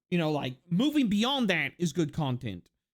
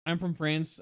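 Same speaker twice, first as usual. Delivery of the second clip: ordinary talk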